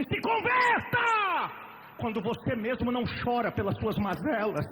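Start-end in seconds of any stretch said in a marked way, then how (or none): 0.0-1.7 high, slow, loud mocking command; hammed-up shouted rebuke; mock preacher shout. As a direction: parody a harsh preacher yelling. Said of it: "dizer assim".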